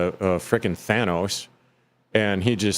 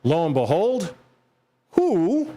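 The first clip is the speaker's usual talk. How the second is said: mock dramatic reveal; 7 semitones higher than usual. Dramatic reveal of new team.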